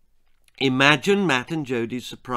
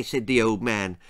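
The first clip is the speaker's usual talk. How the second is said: usual speaking voice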